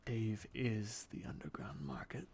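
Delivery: unvoiced secret whisper. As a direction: lean in and breathe it. Goblin confides secret.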